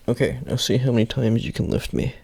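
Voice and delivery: loud gym spotter bark; loud coaching call. Acted. Spotting a lifter at bench.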